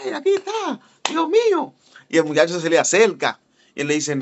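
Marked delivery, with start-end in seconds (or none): 0.0-1.7 high, thrilled mock cry of the crowd; excited crowd. Church members spotting Luis Urbáez, high thrilled cry. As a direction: gasp it out like fans spotting a star. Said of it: "la atmósfera de la gente".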